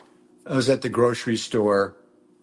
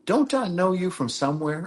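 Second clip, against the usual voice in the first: high-pitched quote of a curious customer; imitating woman customer; 8 semitones higher than usual. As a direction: voice her question in a raised pitch. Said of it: "she says".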